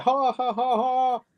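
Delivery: high, exaggerated fake ha-ha; mock laugh. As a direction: spell out a laugh up high, hammed up. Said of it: "I didn't laugh, like, out loud".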